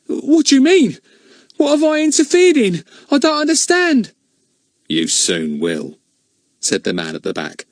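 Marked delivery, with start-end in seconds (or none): 0.0-4.1 high, loud, alarmed voice; panicked questions; frightened boy. Acted. Ern frightened by the men. 4.9-6.0 slow, low, menacing; the man in back answers Ern slowly and low. Acted.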